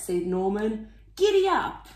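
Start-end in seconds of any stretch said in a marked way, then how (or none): 1.2-1.9 high bright rallying call; giddy up call. A narrator's reading. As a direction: spur them on. Slug urges snails on.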